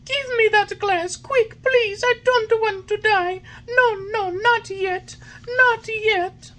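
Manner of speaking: high, loud desperate wail; crying out. A narrator's reading. Puppet crying out to the fairy in panic. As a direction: beg in panic.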